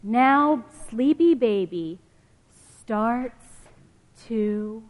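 lively storybook reading voice; reading to children. Acted. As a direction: read it like a picture book for kids. Reading picture book aloud.

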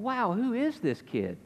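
slow, high, wide-eyed wonder; amazed teachers. Retold or imitated. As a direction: marvel at the boy like an astonished teacher. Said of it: "They're going".

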